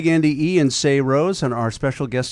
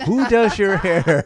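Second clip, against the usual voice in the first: laughing repeat; 7 semitones higher, 4 dB louder, slower than usual. Real, not acted. Host repeats question laughing.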